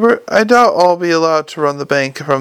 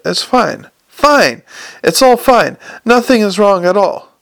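raised pitch, overinsistent reassurance; frantic insistence; 5 semitones higher than usual. Acted. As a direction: insist everything is fine, voice jumping higher. Hubert frantically insisting all is well.

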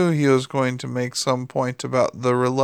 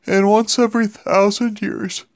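eternity tale; 7 semitones higher, 5 dB louder than usual. Acted. Crowley spins the eternity tale.

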